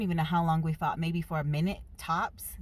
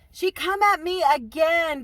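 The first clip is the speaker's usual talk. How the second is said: much louder, higher outraged yell; outraged shout; 10 semitones higher, 11 dB louder than usual. Real, not acted. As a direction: shout it out in disbelief. Outraged at old lady.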